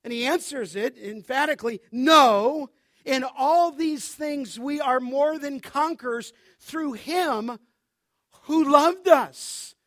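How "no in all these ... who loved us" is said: loud, with the last words slowed right down; triumphant pulpit declaration; emphatic proclamation. Acted. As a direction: land the final words slowly and loudly. Preacher proclaims Paul's answer.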